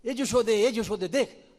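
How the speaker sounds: loud, high calling across the yard. Acted. Gopi calls Yashoda to see the caught thief, loud calling. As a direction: shout for Yashoda to come look.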